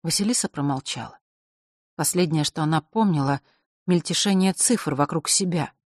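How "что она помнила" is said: ordinary delivery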